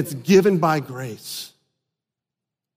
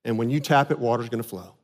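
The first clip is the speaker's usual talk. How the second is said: lower, softer calm voice of God; God calmly instructing Moses; 7 semitones lower, 5 dB quieter, faster than usual. Retold or imitated. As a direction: give the command gently and low. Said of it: "He said".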